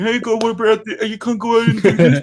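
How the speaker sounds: high, fast nagging warnings; mock the world nagging you. Mocking the noisy world's nagging.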